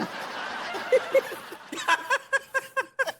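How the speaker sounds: stifled giggles bursting out; snickering laugh. Acted. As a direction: burst into mocking, high-pitched snickers. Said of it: "हंसी क्यों आ रही है तुम्हें बे". (दबी हुई हंसी का फूटना)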